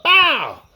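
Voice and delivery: high, loud mock punch noise; punch sound effect. Smith landing a right hook, shouted punch noise. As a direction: smack it out like a comic-book hit.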